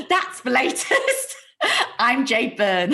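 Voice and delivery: giggling, slowed news sign-off; laughing sign-off. Real, not acted. Jade fumbling her news sign-off, laughing. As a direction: try to sign off through the giggles. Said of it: "forgot what I was supposed to say at the end".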